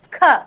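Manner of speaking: breathy burst after K; K with H sound. Shown as a demonstration. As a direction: release K into an audible h. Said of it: "With the H sound it should come".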